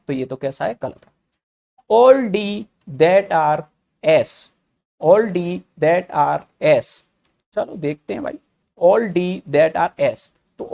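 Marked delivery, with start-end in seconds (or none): 1.9-6.9 very slow, word by word; slow dictation. Shown as a demonstration. Teacher reading the statement slowly. 8.8-10.3 slow dictation. Shown as a demonstration. Teacher rereads the statement.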